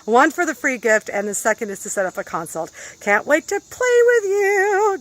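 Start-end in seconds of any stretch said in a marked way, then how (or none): 3.0-5.0 high, drawn-out singsong sign-off; slow, high, gleeful farewell; playful anticipation. Real, not acted. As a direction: stretch it out high and eager.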